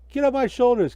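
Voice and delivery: high, loud yell. Acted. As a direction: shout her off your back. Hinkle yells at Karen.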